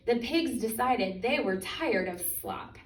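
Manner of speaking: plain reading voice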